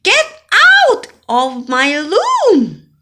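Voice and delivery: put-on loud, high, slow childish yell; shouting. Bella shouting at Bob to leave her room. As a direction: yell it at the baby brother. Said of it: "So I shouted".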